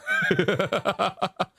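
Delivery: breathy high laugh; embarrassed laugh. Real, not acted. Laughs forgetting lyrics.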